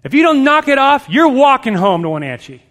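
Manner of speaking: loud threat, starting high and dropping; snapping mom. Retold or imitated. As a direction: blow up like a mother who snaps. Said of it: "then she snaps".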